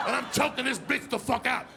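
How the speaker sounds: normal talking voice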